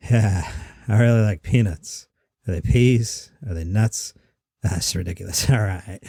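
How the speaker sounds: low, subdued comic cracking himself up; half-ass Mitch Hedberg. Shown as a demonstration. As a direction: drop low and chuckle at your own silly joke. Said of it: "I was imagining Mitch Hedberg doing it".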